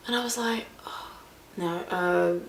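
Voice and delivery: whispered, caught-out denial; hushed, hoping not to be noticed; whispering. Retold or imitated. Caught out, answering nervously.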